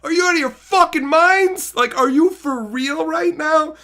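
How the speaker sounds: high, loud, laughing incredulity; laughing mock disbelief. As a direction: laugh at the absurd plan. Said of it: "I would have been like".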